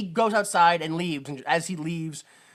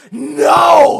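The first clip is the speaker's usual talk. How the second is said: loud, high, hammed-up protest; outraged shout; 9 semitones higher, 15 dB louder than usual. Viewer yelling back at the film, outraged shout. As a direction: bellow it in mock outrage. Ranting at the film's joke.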